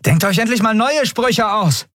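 high-pitched jeering comeback; shouted retort. A narrator's reading. Andriel answering the enemy's threats, shouted retort. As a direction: yell back at the attackers. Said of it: "gab Andriel zurück".

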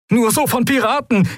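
very high, strained radio fragment; static-broken distress voice. A narrator's reading. Stranded captain warns of pirates through static, very high strained voice. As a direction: warn urgently through the static. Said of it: "völlig verrauscht".

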